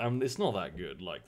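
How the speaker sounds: ordinary talk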